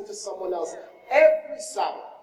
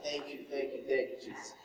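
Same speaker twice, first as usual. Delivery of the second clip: much softer and lower, a hushed whisper; secret whispered thanks; 8 semitones lower, 13 dB quieter than usual. Retold or imitated. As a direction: breathe it so he can't hear. Secret thanks retold quietly.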